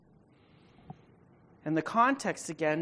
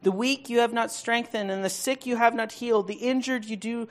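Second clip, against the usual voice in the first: higher, louder prophetic reading; preaching at full force; 5 semitones higher, 9 dB louder than usual. Acted. Pastor reading God's rebuke of Israel's shepherds, booming.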